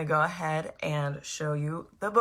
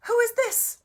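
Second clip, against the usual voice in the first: high curious voice. Acted. Narrator voices curious asker.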